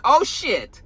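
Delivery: a mild retold yell. Viewer yelling at the screen, a mild mock shout. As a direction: blurt it out in shock. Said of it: "I did also yell out".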